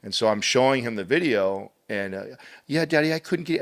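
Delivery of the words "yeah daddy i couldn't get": imitating his young son. Father voices his son.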